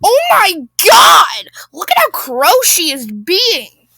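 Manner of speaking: slow, high, loud cry of disgust; hammed-up scandalized shout; raging Karen. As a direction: stretch the words in mock outrage. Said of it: "that makes Karen rage even more".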